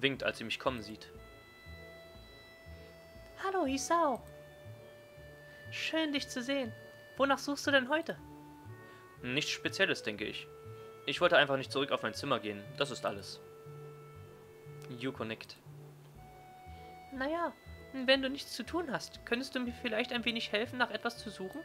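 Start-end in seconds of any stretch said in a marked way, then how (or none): 3.4-8.2 put-on high, friendly librarian girl. Yuko voiced by narrator. 17.1-21.6 put-on high, friendly librarian girl. Yuko voiced by narrator.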